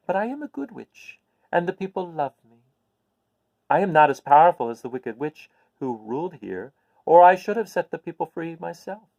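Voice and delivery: put-on a little old witch, pleased to share good news.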